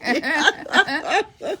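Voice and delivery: high burst of real laughter. Real, not acted. Reader cracking up at Pippi's joke, real laughter. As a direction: laugh out loud mid-story.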